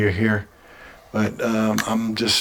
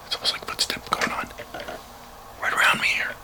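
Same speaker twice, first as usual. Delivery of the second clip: hushed, breathless whisper; nervous whisper; 5 dB quieter than usual. Real, not acted. Spotting something strange in the dark room, nervous whisper. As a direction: whisper so nothing hears you.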